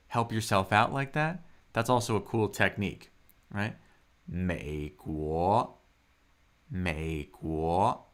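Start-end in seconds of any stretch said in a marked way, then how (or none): 4.0-8.0 "美国" slow, low dip, then rising; third tone, second tone. Shown as a demonstration. Teacher drilling tones into muscle memory, slow low-then-rising "美国". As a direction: draw out "美国", low on 美, climbing on 国. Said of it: "A third tone followed by a second tone".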